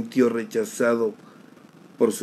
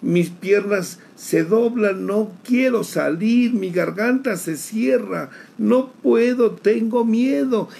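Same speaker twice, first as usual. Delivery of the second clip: higher, rising panicked pleading; reliving panic; 6 semitones higher than usual. Retold or imitated. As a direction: climb in fear toward tears. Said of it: "y me solté a llorar".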